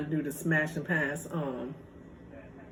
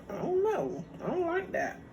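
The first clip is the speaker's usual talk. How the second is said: high whiny I don't like that; squeaky complaint; 11 semitones higher than usual. Real, not acted. Complaining about the bean burrito, high and whiny. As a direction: whine it up high. Pitch jumps way up.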